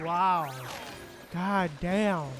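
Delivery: ordinary talk, nothing marked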